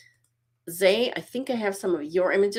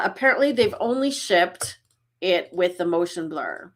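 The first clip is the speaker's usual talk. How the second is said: ordinary delivery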